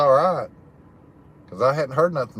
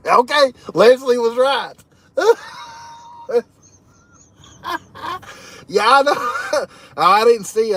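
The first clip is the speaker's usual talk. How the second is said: laughing; 9 semitones higher, 6 dB louder than usual. Real, not acted. Leslie guessed the secret right.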